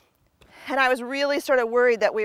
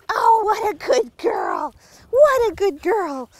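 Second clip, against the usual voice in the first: slow, higher cooing praise; slow doting praise; 8 semitones higher, 3 dB louder, slower than usual. Real, not acted. Trainer cooing praise at her returning dog, slow and high. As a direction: dote on her as she comes back.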